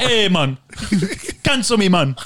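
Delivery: mock Jamaican dare; Jamaican accent. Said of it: "the thing we get canceled for".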